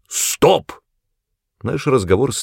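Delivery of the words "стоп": high-pitched, insistent halt; exasperated cry to stop; shouted command. A narrator's reading. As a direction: cut the other off sharply. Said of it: "крикнул Аас".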